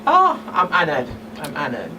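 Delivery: ordinary talk, nothing marked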